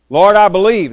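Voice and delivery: loud high cry of belief; desperate cry. Acted. Father of sick child crying out to Jesus, loud plea. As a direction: call it out like a father begging.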